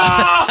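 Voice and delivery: startling yell; scream. Retold or imitated. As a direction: let out a sudden scream. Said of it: "Fait que je hurle".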